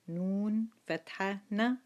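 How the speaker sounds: nun with fatha said clearly as نون فتحة ن; syllable drill. Shown as a demonstration. Teacher drilling students: نون فتحة ن. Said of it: "We say".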